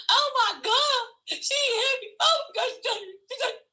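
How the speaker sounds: high-pitched theatrical wailing; hammed-up screaming. Acting out for the mall after throwing herself down, hammed-up screaming. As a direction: re-enact a put-on victim's scream. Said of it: "I started screaming".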